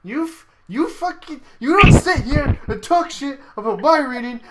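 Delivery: high, loud, indignant swearing; angry outburst. Real, not acted. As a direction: snap back, offended at the insult. Angry at teasing about reading.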